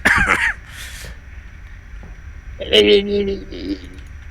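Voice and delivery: high squawky duck noises; Donald Duck voice. Acted. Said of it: "That was a terrible Donald Duck impression".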